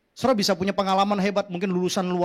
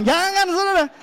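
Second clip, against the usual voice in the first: emphatic warning; 10 semitones higher, 5 dB louder than usual. Real, not acted. Preacher warns against harsh words.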